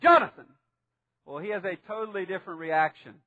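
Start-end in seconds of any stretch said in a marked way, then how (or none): 0.0-0.4 loud, high call of a name; sharp rebuke. Shown as a demonstration. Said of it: "a voice of rebuke".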